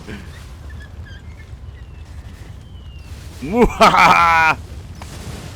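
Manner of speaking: breathy maniacal villain cackle; evil villain laugh. Acted. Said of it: "see me turn this evil".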